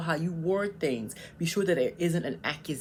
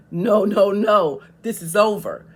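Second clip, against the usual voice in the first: much louder, cutting the shouting off; fed-up refusal; 3 semitones higher, 10 dB louder, slower than usual. Retold or imitated. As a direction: slam the door on the conversation. Acting out shutting down a yeller.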